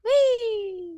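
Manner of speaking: high delighted whee; excited squeal. Real, not acted. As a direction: squeal with glee. Cheering at a donation.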